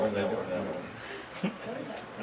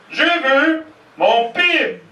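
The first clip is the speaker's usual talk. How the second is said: stubborn yell; 13 semitones higher, much slower than usual. Retold or imitated. Brother-in-law demanding his straw from the manager, slow high yell. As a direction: drag every word out in a high stubborn yell. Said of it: "has a famous Irish temper".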